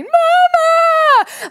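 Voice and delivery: long, high, loud call down the hall; yelling Mama. Retold or imitated. Son yelling for his mother down the hallway. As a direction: call out for mom at full volume.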